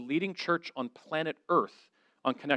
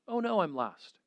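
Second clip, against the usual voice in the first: higher, softer mock lament; mock dismay; 4 semitones higher, 6 dB quieter than usual. Jesus up in heaven worrying he is last, mock dismay. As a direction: play a put-out Jesus, pitched up and soft.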